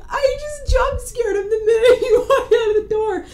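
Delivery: high, laughing through the words. Real, not acted. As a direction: giggle through the confession. Retelling while laughing hard.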